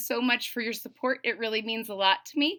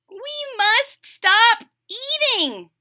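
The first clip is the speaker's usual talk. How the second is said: higher, louder slow toad protest; Toad crying out; 13 semitones higher, 6 dB louder, much slower than usual. Acted. Toad protesting while still eating, high and loud. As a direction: protest while reaching for more. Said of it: "cried Toad".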